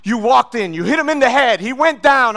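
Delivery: loud, high pushy interrogator voice; mock pushy detective. Detective pressing a suspect, loud and high. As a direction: bark the story at him like a cop. Detectives pushing their version loudly.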